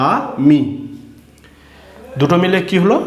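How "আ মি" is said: "আ মি" as two separate stretched sounds; broken into syllables. Shown as a demonstration. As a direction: say "আ মি" with a clear gap after আ. ("আ মি" ভেঙে ভেঙে টেনে পড়া)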